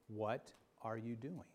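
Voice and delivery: soft, low questioning; hushed still small voice; quiet voice. Acted. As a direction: ask it gently and low. Quiet voice of God.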